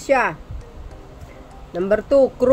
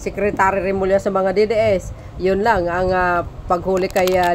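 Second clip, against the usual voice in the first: ordinary delivery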